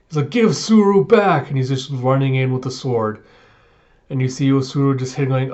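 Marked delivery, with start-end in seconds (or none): 0.3-1.5 slow, loud, high demand; a retold furious shout; angry demand. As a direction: charge in demanding her back. Charging with sword.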